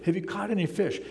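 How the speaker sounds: mock yell, pitched well up; retold yell. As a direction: call it out lightly, as if across water. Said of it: "it's Jesus yelling out to them".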